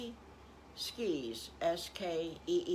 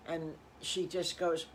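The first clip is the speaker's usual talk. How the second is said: plain reading voice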